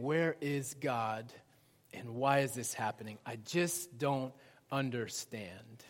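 slow, low, soft lament; quiet bewilderment. Acted. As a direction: question softly, slowing as you sink. Voices believers left questioning God.

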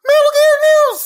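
very high, loud stinger yell; shouted jingle. Pretend. Co-host announcing the news segment, in a high shout. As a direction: belt it out high like a hammed-up jingle.

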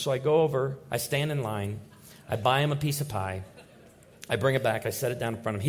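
ordinary talk, nothing marked

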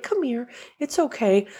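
soft, gentle coaxing to a scared pet; soothing. Retold or imitated. Comforting a scared pet, soft and gentle. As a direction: coax softly like calming a frightened animal. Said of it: "you're gonna be like".

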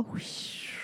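breathy wind whoosh. Acted. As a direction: blow like the wind. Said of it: "Make your leaves fall".

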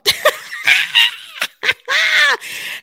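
high, bursting laugh; relieved laugh. Real, not acted. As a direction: laugh out loud in a rapid burst. Laughs after coming through it.

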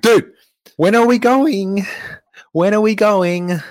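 high, drawn-out exasperated whine; impatient whining. Retold or imitated. Passenger pestering a dawdling bus driver, impatient whine. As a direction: call it out high, stretching each word.

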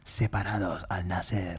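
spooky whispered aside; hushed, slow dramatic whisper. Acted. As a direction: whisper it slowly, like a horror trailer.

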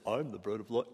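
low, soft put-on voice; mock self-proclamation. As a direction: lightly imitate someone making a grand claim. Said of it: "anybody that talks like this".

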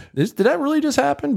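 high stunned disbelief. Retold or imitated. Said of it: "I'm like".

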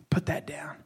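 low firm whisper; quietly insist, low and firm; whispered command. Acted. God telling the doubter to stop, whispered.

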